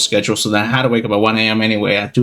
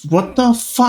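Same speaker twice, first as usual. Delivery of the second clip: baffled outburst; 11 semitones higher than usual. Retold or imitated. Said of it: "I'm like".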